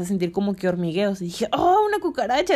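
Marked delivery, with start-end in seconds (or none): 1.5-2.5 high, startled cry at a roach; sudden alarm, pitched up; retold jolt of fright; startled gasp. Retold moment feeling bugs.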